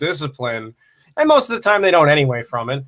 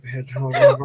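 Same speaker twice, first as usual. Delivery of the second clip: laughing; faster than usual. Real, not acted. Laughing fit over the book.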